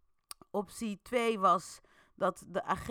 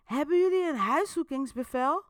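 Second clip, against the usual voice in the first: raised, higher challenge; defiant reply; 9 semitones higher, 7 dB louder than usual. A narrator's reading. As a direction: push back at the cops. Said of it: "Waarop Boels antwoordt".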